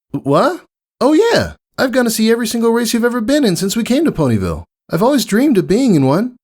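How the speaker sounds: very high, fast, gushing filly; eager fan spilling her dream; excited Scootaloo. A narrator's reading. Voices Scootaloo.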